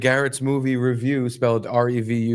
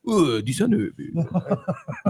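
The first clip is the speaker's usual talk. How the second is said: mock Italian voice; 4 semitones higher, much slower than usual. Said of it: "the stupid Italian voice".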